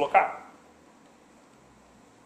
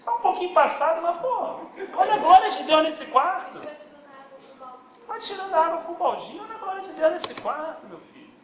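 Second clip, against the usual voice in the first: high-pitched, cheerful brush-off; imitating a faithful sick woman; 9 semitones higher than usual. Dying sister answering the pastor from her bed, imitated high and undaunted. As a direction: play the undaunted church sister, pitched well up. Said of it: "Pô, irmã, tá brabo?".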